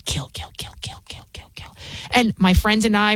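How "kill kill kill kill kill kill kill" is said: whispered, repeated in a chant; eerie whisper. Retold or imitated. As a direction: hiss it like a deranged man pacing. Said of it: "pacing back and forth and going".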